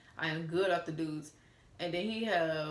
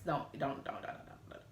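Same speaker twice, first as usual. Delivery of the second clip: fading to whisper; 4 semitones lower, 5 dB quieter than usual. Real, not acted. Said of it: "fading don'ts".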